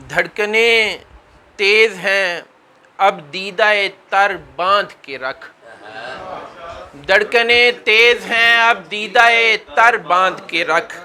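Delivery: sustained declamatory Urdu verse; mushaira verse recitation. Acted. Poet reciting naat couplets to an audience, stately recitation. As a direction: let key words ring, settle on the refrain.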